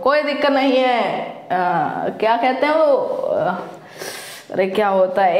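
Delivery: ordinary talk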